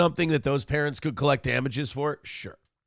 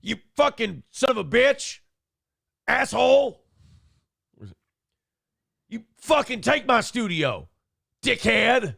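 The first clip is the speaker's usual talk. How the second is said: imitating Alex cursing; 6 semitones higher than usual. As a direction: rattle off swears as punctuation. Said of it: "He's like".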